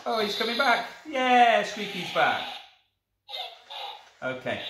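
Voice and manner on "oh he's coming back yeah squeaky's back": excited. Real, not acted. Toy reinflates.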